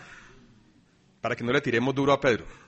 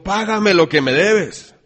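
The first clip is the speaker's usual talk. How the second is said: loud, higher, slow furious demand; angry demand; 7 semitones higher, 11 dB louder, slower than usual. Acted. Servant choking his fellow servant, loud angry demand. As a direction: bark the order at the debtor.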